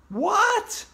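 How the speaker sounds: high, loud startled what; shocked. Acted. Kid hearing of summer reading, shocked. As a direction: recoil at the idea.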